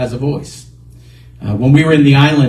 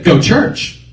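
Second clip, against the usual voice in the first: higher, firm island-style order; insistent inner voice; 5 semitones higher than usual. Retold or imitated. Voice returning to the driver, insistent command. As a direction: press the command home. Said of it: "that voice came again and said".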